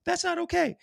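high-pitched outraged mimic; mock indignant. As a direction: squeak it out offended.

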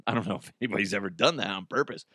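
normal talking voice

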